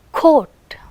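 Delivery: "Coat" with a puff of air after the k; aspirated k. Shown as a demonstration. Teacher letting students hear coat, k aspirated. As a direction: release the k of "Coat" with audible breath. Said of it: "hear the aspiration in coat".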